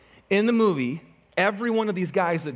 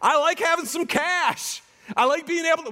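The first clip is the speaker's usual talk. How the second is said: complacent believer; 10 semitones higher than usual. Retold or imitated. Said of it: "it's like".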